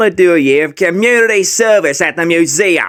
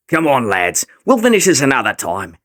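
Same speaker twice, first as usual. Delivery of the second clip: ordinary delivery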